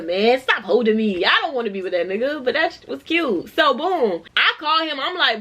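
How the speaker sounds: normal talking voice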